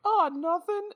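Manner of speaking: very high squeaky denial; fake-innocent pawnbroker. Jeeves in pieces denying what happened, squeaky innocence.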